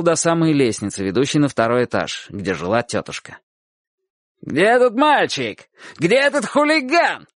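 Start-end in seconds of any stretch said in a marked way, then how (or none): 4.4-7.3 angry yelling. A narrator's reading. Dog-catcher hunting a boy in the yard, yelling. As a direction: bellow it high, stretching the words. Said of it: "орал он".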